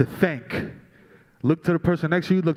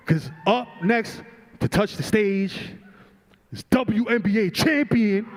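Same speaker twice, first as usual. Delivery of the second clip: rally speech; 6 semitones higher than usual. Real, not acted. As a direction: hype up the introduction. Announcing the next speaker.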